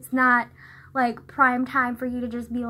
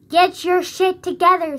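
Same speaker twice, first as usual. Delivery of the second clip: louder, higher, slow emphatic telling-off; mock scolding; 6 semitones higher, 9 dB louder than usual. Scolding people who say library wrong, mock exasperation. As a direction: punch out each word slowly and loudly.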